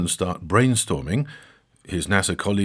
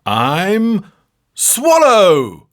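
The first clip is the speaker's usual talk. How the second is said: high, loud showman's cry; hammed-up triumphant reveal; triumphant punchline; 9 semitones higher, 9 dB louder, much slower than usual. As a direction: deliver it with a grand flourish. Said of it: "delivering his final choice".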